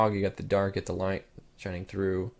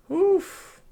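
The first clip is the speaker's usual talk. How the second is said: short, high "oof" exclamation; high-pitched oof; 5 dB louder than usual. Real, not acted. Vlogger reacting to a picture, a high-pitched oof. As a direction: let out a sudden high oof.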